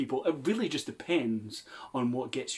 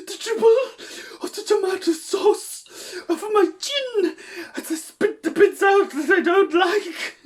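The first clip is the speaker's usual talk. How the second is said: high, loud laugh-crying wail; overacted grief breaking into laughter; hammy sobbing; 9 dB louder than usual. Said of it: "I'm gonna read aloud".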